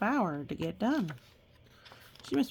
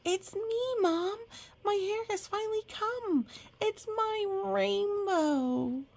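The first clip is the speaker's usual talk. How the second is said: put-on much higher, slow, delighted child voice; joyful little girl; 12 semitones higher than usual. A narrator's reading. Little girl showing Mom her new hair, delighted. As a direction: squeal it like a thrilled kid. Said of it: "Trinity said".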